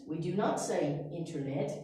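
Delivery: ordinary talk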